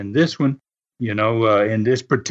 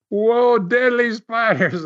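startled whoa; 8 semitones higher, slower than usual. Retold or imitated. Retelling shock at seeing spiders.

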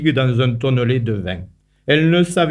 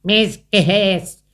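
grumbling spider, pitched higher; a mildly cross spider scolding; spider's grumbling; 6 semitones higher than usual. A narrator's reading. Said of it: "a ruganiera aragna da despiete culera".

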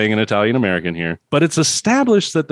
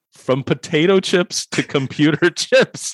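"From potato chips to computer chips" read higher and slow, breaking into a laugh; mock headline read; 7 semitones higher, slower than usual. Real, not acted. Host reading a silly magazine headline aloud, mock-grand and laughing. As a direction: grandly announce the headline and crack up. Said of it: "let me just read you what the fucking headline says".

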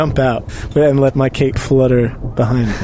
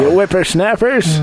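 put-on grumpy old man; 6 semitones higher than usual. Joining the cranky old-timer bit.

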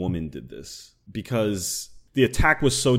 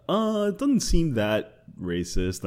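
drawn-out, higher-pitched mock obliviousness; mock dismissiveness; 8 semitones higher, slower than usual. Voicing people who don't think about that, mock dismissive. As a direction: imitate someone slowly shrugging it off. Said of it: "they're just kinda like".

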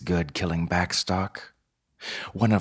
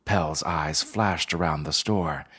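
plain reading voice

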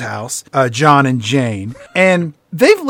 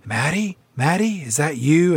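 softly calling out, questioning; tentative calling; 6 dB quieter than usual. Retold or imitated. Griffin walking around looking for Maddie, tentative calling. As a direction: call gently into an empty house. Acting Griffin searching the house.

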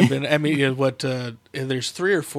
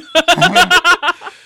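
loud laughter; 11 dB louder than usual. Real, not acted.

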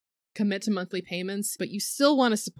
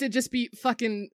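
normal talking voice

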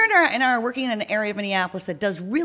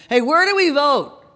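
higher, louder shout through an intercom; calling out; 7 semitones higher, 5 dB louder than usual. Acted. Man on intercom asks canvassers, calling out. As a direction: call out a question through a speaker. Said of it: "the guy in the speaker calls out again".

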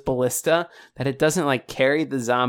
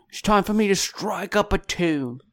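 put-on higher Australian accent; 6 semitones higher than usual. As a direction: sing it out Aussie style. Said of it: "he's Australian now".

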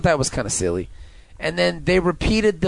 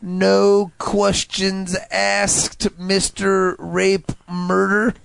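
very slow, deliberate mock line; slow drawn-out; 4 semitones higher, much slower than usual. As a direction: stretch every word slowly.

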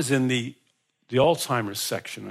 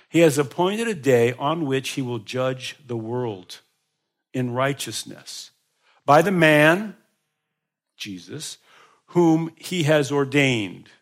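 slow scripture reading; slower than usual. Acted. Reading scripture slowly in sermon.

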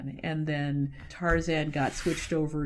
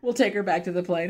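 normal talking voice

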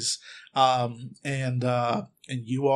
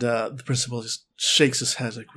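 ordinary delivery

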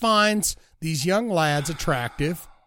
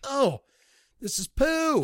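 high, drawn-out mock dismay; 8 semitones higher, slower than usual. Film fan recalling a terrible found footage movie, mock dismay. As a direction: let the disappointed reaction rise high and stretch.

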